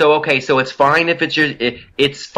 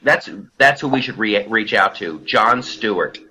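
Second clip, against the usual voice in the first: ordinary talk, nothing marked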